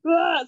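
high, loud mock "ah!"; short exasperated yelp, played up; imitated cry of frustration; retold frustrated cry. Retells her frustration in the car.

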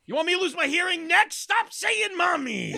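high, hammed-up parental meltdown; exasperated yelling. Playing a parent fed up with hearing mommy, exasperated yelling. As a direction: yell it high as a comic parent at wit's end. Mock outburst at pestering child.

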